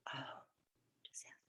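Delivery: barely audible, under the breath; said to yourself. Shown as a demonstration. Student repeating the word silently to themselves, very quiet. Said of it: "just say it to yourself".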